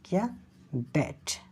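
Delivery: ordinary talk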